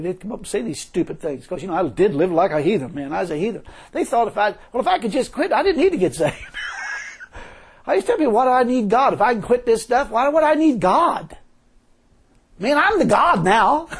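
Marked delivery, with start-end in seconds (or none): 3.9-13.9 raised, loud preacher's cry; rising revival preaching; preaching at full force. Acted. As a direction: preach it out to the crowd.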